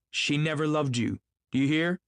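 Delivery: high, insistent outburst; impassioned cry. A narrator's reading. Gatsby insisting to Tom about Daisy, impassioned cry. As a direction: cry it out, pitched well up. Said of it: "he cried".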